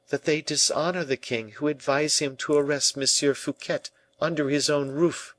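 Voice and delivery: high, fervent declaration; heartfelt La Valliere. A narrator's reading. La Valliere defends the king's honor, heartfelt and fervent. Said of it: "with such an accent that the heart".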